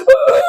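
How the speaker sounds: high hammed-up ooh wail; fake actor wail. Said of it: "அழுவான்".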